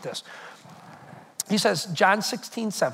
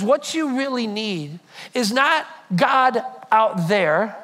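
slow emphasis; 3 semitones lower, slower than usual. Acted. Slowed, emphatic scripture.

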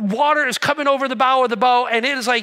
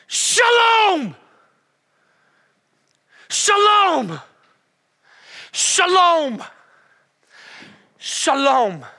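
huge, drawn-out falling shout; commanding shout; 7 semitones higher, 6 dB louder, much slower than usual. Acted. Jesus commanding the storm from the boat's edge, booming shout. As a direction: bellow it out over the storm, easing down by the last. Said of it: "He might even be screaming over you".

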